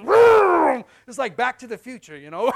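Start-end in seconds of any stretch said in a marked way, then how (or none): 0.0-0.8 loud, high engine vroom; joyful mock engine roar; car vroom. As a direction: shout a cartoon car engine revving. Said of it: "and Jesus goes".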